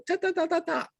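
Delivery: imitated spirit chatter. Spirits answering with the light.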